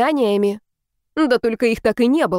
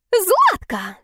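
much higher, piercing shriek from the street; shrill screeching neighbour; 11 semitones higher than usual. A narrator's reading. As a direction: screech the name across the yard. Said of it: "визгливо заверещали с улицы".

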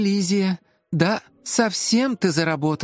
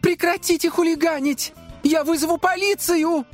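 very high, indignant threat; high-pitched panicked protest; frightened crying out. A narrator's reading. Assistant threatens unseen hooligans, crying out. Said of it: "закричала Элизия".

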